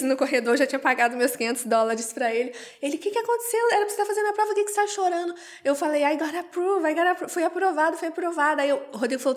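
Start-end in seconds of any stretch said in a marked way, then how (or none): 3.0-5.3 pergunta aflita em voz bem aguda; espanto imitado. Retold or imitated. Chinês espantado com o choro dela. 6.0-8.6 notícia dada em tom agudo e emocionado; euforia revivida. Retold or imitated. Conta a notícia da aprovação chorando.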